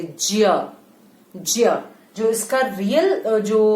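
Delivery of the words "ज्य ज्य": ज्य said as dzya, half j plus y; old pronunciation. Shown as a demonstration. Teacher showing kids the old Hindi sound. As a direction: say ज्य with j gliding into y. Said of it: "पुरानी हिंदी में इसको ज्य बोलते थे".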